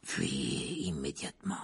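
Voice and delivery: low murmur. Acted. Sirius urges the children to flee, low slow murmur. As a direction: press the warning low and slow. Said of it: "murmura Sirius".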